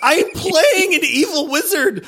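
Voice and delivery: high, laughing wail; laughing anguish. Real, not acted. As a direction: laugh out the dilemma.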